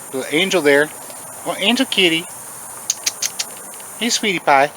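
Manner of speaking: tender high coo; cooing over the shy cat. Real, not acted. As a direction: sweet-talk the kitty.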